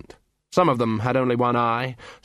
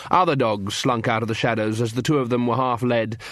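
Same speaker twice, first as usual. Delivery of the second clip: ordinary delivery, nothing marked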